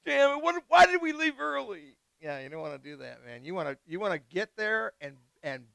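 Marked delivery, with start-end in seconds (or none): 0.0-2.0 high, louder whiny griping; hammed-up gripe about leaving early; mock complaint. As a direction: imitate a grumbling traveller. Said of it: "complain and go".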